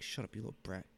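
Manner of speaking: quick, very soft joking retort; muttered mock scolding. Dad jokingly answering his little daughter, muttered mock scolding.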